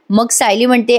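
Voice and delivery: ordinary talk, nothing marked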